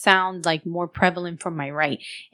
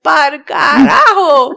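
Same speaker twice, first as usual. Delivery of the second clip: slow, louder and higher "Par carajo"; stretched-out, louder "Par carajo" in mock horror; loud mock outburst; 8 semitones higher, 12 dB louder, much slower than usual. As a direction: bark it out in slow, hammed-up disgust. Retold exclamation on hearing "mi indio.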